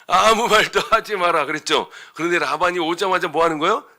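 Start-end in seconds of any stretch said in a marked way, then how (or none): 0.1-1.5 loud, higher-pitched divine warning; God's stern command. Retold or imitated. Preacher quoting God's dream warning to Laban, commanding. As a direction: voice God giving a forceful order. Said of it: "하나님 뭐라 그러셨죠".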